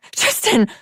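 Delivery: frantic call to a fallen friend; crying out. A narrator's reading. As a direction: cry his name in alarm. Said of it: "she cried".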